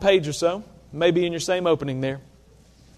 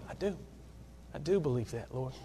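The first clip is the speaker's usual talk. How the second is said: quiet heartfelt reply; 4 semitones lower, 13 dB quieter than usual. Acted. Personal reply to Jesus' question.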